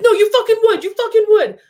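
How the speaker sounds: loud, high-pitched shout mimicking an irate man; imitated angry yelling. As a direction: yell it back at the producer as he did. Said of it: "he's yelling to the producer".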